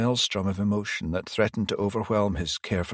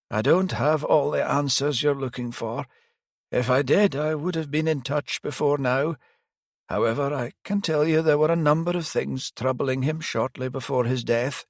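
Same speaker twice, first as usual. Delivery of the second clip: put-on higher old priest voice, rueful; elderly priest; 7 semitones higher than usual. Priest admitting he failed the detective, higher pitched. Priest reluctantly shares information.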